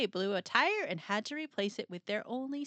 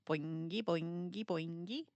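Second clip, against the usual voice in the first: softer, springy rising boings; bouncy sound effect; 3 semitones lower, 6 dB quieter than usual. Acted. Narrator voicing gear bouncing free, soft springy boings. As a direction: bounce each word up like a spring.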